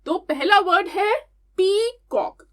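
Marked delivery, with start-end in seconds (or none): 1.6-2.4 पीकॉक split into two beats, पी, then कॉक; teacher sounding out पीकॉक in two parts; syllable by syllable. Shown as a demonstration. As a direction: break पीकॉक into two separate syllables for clapping. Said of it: "चलो मेरे साथ repeat करो".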